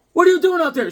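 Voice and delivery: high, loud mock-mother scold; high scolding mom. Mother spotting her frozen son outside, high mock scold. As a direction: shriek it like an alarmed mom. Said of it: "She's like".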